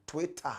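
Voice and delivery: "Twitter" with a full, clear t, Ghanaian style; Ghanaian pronunciation. Shown as a demonstration. Host showing how Ghana says it, "Twitter" as "Twe-tah". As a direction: say "Twitter" as "Twe-tah", both syllables sounded. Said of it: "we in Ghana and Africa say".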